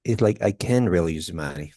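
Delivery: "can" said short and weak in "I can really use the money"; reduced can. Shown as a demonstration. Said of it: "Remember the short".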